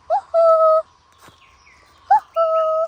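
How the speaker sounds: high, loud hooting call. Real, not acted. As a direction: hoot out to carry far. Calling out for lost cat.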